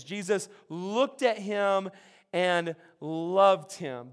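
slow, every word weighed; slow savoring. Shown as a demonstration. Pastor dwells on the verse, slow and tender. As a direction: linger on each word so it sinks in. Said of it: "just this sentence".

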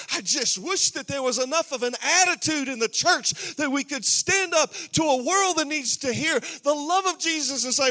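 loud, climbing, stirring preaching; rally the church to stand up; full-force preaching. Acted.

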